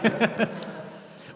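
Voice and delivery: breathy burst of laughter; high laugh. Real, not acted. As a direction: let out a high, airy laugh. Laughing at topless churchgoer story.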